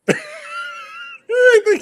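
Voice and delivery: high, slow, laugh-cracked jab; laughing shout. Real, not acted. Laughing mockery.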